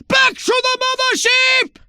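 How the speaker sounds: very high, loud command; calling his agent back in a panic; urgent shouted order. Acted. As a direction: yell the retreat order.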